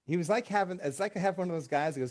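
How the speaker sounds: ordinary delivery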